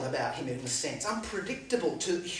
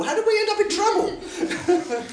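laughing disbelief; 7 dB louder, slower than usual. Retold or imitated. Disciples' bewilderment voiced.

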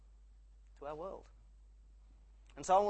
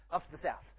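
ordinary talk, nothing marked

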